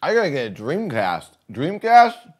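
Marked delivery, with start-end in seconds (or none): 1.5-2.3 high, loud startled echo; surprised. Real, not acted. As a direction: repeat it in sharp surprise.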